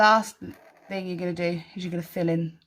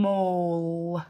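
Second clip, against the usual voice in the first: "Mull" drawn out from a long m; "Mull" in slow motion; slowed like a snail; 2 semitones lower, 3 dB quieter than usual. Shown as a demonstration. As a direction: stretch every sound in "Mull". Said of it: "slow it down like a snail".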